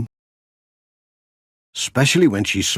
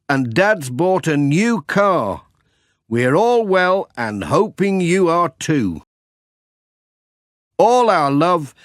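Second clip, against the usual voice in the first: lightly raised voice reading a letter out; 7 semitones higher than usual. Acted. Pat reads the letter aloud.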